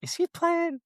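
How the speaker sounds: high, wondering mom voice; mom's suspicious question. Retold or imitated. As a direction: voice mom asking if he's joking. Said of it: "and she was like".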